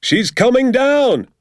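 high, loud, drawn-out call; father's excited high holler; urgent shout. A narrator's reading. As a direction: shout the news out across a field. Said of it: "my father shouted".

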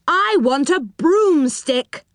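high, slow insistence; drawn-out, determined demand; insistent demand. A narrator's reading. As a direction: stress each word of the demand. Moonface demands a broomstick.

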